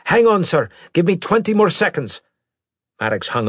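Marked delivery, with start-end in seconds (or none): 0.1-2.2 loud, high-pitched plea; urgent interruption. Acted. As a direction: cut in on the captain urgently. Pilot cuts off captain in emergency.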